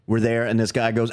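ordinary delivery

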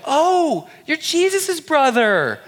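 starstruck partygoers. Retold or imitated. Party guests gush over James, high and slow. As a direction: gush like meeting a celebrity's sibling. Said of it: "people are like".

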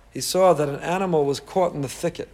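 ordinary talk

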